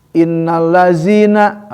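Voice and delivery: innallazina slow, every sound drawn out; careful recitation. Shown as a demonstration. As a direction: recite innallazina slowly and fully. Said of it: "itu masalah".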